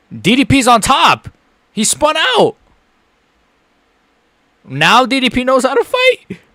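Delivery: high, loud cheering burst; excited yelling. Real, not acted. Streamer cheering his fighter's escape, high excited yells. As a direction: shout as your fighter breaks free.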